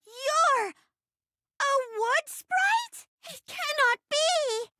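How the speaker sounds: high, drawn-out stage disbelief; theatrical astonishment. Acted. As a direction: stretch it out, aghast and high. Sword Lord's astonishment in play.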